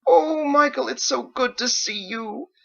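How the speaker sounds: put-on very high, slow, gushing old mother. Elderly mother greeting her son, very high and gushing. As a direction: swoon over him like a doting mom.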